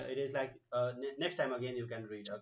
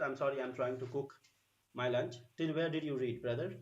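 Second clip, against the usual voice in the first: ordinary talk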